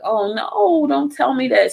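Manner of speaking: high-pitched pleading disbelief, sliding down; retold dismay. Reacting to news a friend passed, dismayed. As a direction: re-enact a dismayed plea, starting very high.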